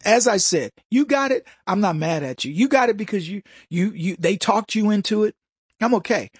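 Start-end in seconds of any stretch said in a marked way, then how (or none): none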